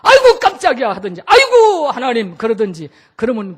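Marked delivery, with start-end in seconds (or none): 0.0-0.9 high loud startled yelp with a laugh; mock-startled shriek; startled cry. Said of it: "소리라도 질러요". 1.2-2.3 startled cry. Retold or imitated. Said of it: "소리라도 질러요".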